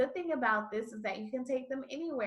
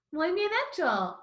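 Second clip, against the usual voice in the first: excited praise; 8 semitones higher, 4 dB louder than usual. Real, not acted. Teacher praises after drill.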